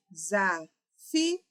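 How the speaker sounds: "desafio" split slowly into de-sa-fi-o; syllable by syllable. Shown as a demonstration. Teacher shows there is no diphthong, "desafio" slowly syllable by syllable. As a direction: separate every syllable of "desafio", with i and o apart. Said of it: "Também não há um ditongo ali".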